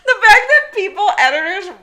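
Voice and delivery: high, loud laugh breaking through the words; cracking up in disbelief. Real, not acted. Laughing at the editors who let it through.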